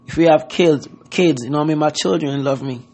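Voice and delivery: usual speaking voice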